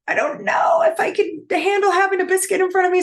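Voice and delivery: high, loud, tearful mock panic; panicky wail. Retelling her Atkins dinner to viewers, a high loud tearful wail. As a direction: wail it like the biscuits are a threat. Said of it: "I was like".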